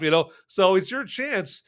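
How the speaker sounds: usual speaking voice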